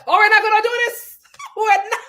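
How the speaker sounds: laughing while shutting it down; laughing retort. Retold or imitated. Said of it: "And Alexia was like".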